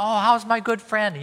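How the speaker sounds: high, loud, sugary mock hello; fake friendly greeting. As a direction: gush over a friend you can't stand.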